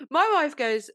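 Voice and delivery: laughing as the story starts; giggling through the setup. Real, not acted.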